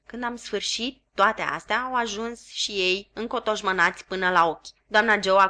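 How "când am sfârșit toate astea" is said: ordinary delivery, nothing marked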